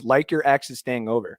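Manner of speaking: normal talking voice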